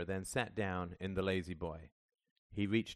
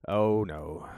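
a touch of sadness, voiced fairly loud; sad; 9 dB louder than usual. A narrator's reading. Father answering his son about crying before, mildly sad. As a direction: let a little sorrow into it. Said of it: "said Dave sadly".

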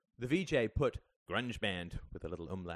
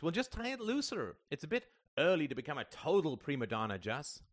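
high-pitched, exasperated dismissal; eye-rolling sarcasm; 6 semitones higher than usual. A narrator's reading. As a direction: belittle the fuss. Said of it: "said Greta, rolling her eyes".

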